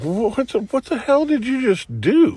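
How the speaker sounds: very high, stammering, rising question; incredulous. Retold or imitated. Storyteller confronting friend who snatched the hat, high-pitched incredulity. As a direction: squeak it out in mock disbelief.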